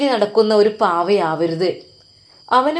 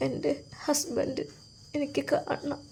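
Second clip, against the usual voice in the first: slow, soft tearful plea; wife asking the doctor for her husband, tearful and soft; crying softly; 5 semitones higher, 13 dB quieter, slower than usual. Acted. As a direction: beg through quiet tears. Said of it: "അത് കരഞ്ഞുകൊണ്ടാണ് പറഞ്ഞത്".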